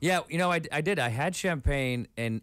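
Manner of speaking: ordinary delivery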